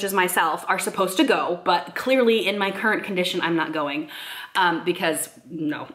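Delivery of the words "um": normal talking voice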